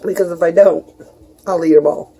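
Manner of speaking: chewing while talking; mouth full. Real, not acted. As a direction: talk through a mouthful. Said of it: "pardon me, while I chew".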